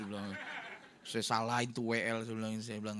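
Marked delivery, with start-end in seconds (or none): none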